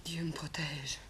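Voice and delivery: breathless whispered plea to God; whispered prayer. Acted. Girl prays to herself as the men argue, whispered. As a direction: pray under your breath. Frightened aside during the quarrel.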